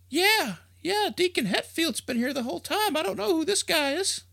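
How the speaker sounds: put-on high, thin old lady voice; old woman. Old woman telling the crowd the deacon has been here, high. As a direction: fuss like a doubtful old churchgoer.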